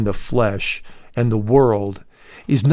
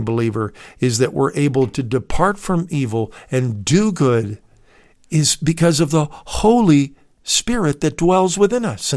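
ordinary delivery

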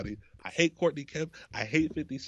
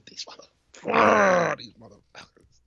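slow, drawn out, then dropping to a whisper; stretched-out fury; 7 semitones higher, 3 dB louder, much slower than usual. Retold or imitated. Fuming at the TV over the episode's ending, slow then whispered. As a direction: let the curse linger then hiss it.

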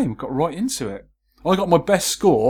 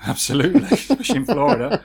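laughing; 6 semitones higher than usual. Real, not acted.

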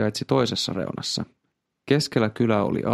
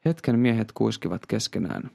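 plain reading voice

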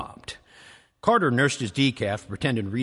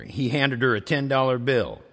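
ordinary delivery

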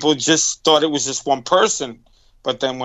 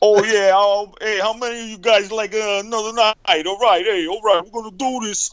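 put-on higher stage-host Brooklyn accent; 5 semitones higher than usual. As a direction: work the crowd like a Brooklyn singer. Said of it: "like an Italian Bay Ridge Brooklyn".

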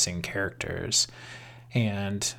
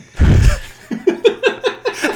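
loud high laugh; 10 dB louder than usual. Real, not acted. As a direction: burst of high-pitched laughter. Laughing at own suggestion.

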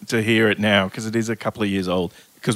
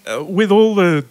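usual speaking voice

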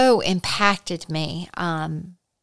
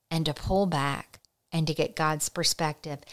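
ordinary talk, nothing marked

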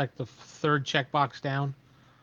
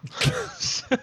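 high laugh. Real, not acted. Laughs after bird call.

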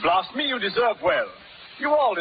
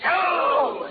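panicked shouting; 7 semitones higher, slower than usual. Acted. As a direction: struggle and plead while lifted. Colonel hauled up to be thrown.

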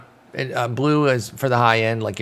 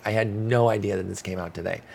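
ordinary delivery

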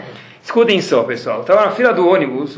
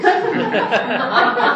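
laughing; 8 semitones higher than usual. Real, not acted. Laughing at his own joke.

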